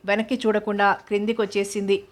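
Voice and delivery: ordinary delivery, nothing marked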